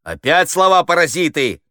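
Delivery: high, loud scolding cry; exasperated shout. A narrator's reading. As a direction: yell at the actor for slipping up. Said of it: "закричал Хуриев".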